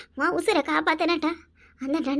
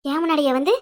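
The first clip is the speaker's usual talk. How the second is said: angry threat; 2 semitones lower, 5 dB louder than usual. Acted. Woman vows against daughter-in-law.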